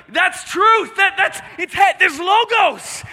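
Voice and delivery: high-pitched exultant cry. Acted. Rocks crying out to God, high exclaiming. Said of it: "the rocks crying out to God".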